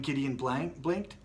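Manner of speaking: ordinary delivery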